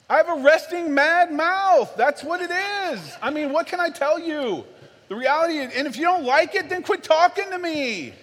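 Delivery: high, worked-up self-defence; indignant anger. Acted. Dad defending his resting mad mouth to his kids, indignant anger. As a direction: rant back, hammed-up and exasperated. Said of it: "That's how I feel".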